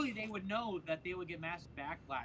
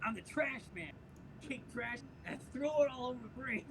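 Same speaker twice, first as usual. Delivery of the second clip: lightly voiced sitcom trash man; a hint of a scrappy character voice, pitched up; Danny DeVito; 8 semitones higher than usual. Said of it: "really good Danny DeVito impression right there".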